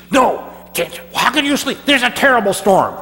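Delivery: high, loud urgent ship captain; alarmed captain. Acted. Captain urgently warns Jonah of the storm, high and loud. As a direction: shake him up with the danger.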